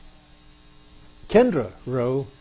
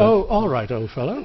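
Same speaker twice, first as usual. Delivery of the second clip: put-on jolly English accent; 6 semitones higher than usual. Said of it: "We can talk in our jolly good English accent".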